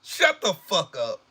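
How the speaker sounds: usual speaking voice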